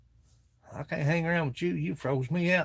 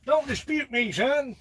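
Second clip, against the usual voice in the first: higher put-on scolding voice; mock stern old man; 10 semitones higher, slower than usual. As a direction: playfully scold like a cranky old-timer, pitched up. Repeats in figure voice.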